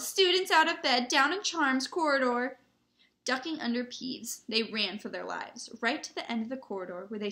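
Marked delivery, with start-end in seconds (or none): 0.0-2.6 high, loud alarm call; bellow. A narrator's reading. Peeves raising the alarm on students, bellowing. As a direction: bellow it down the corridors. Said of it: "Peeves belled".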